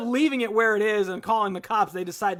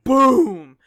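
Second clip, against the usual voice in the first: loud, drawn-out boom; booming impact; 4 semitones higher, 12 dB louder than usual. Retold or imitated. Storyteller mimics a van hitting a man, booming. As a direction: hit it big as a crash sound.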